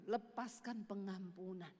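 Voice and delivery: slow, low, lightly voiced divine command; voice of God. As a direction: let each word fall slowly. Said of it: "dengar suara Tuhan tuh ngomong gini".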